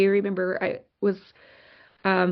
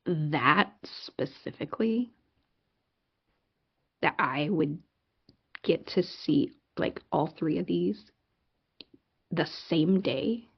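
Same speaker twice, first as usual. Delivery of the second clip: soft, low, halting wonder; hushed, lower voice with long pauses; awed tender prayer; 3 semitones lower, 5 dB quieter than usual. Retold or imitated. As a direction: ask it quietly in humbled amazement. Moved, quiet address to God.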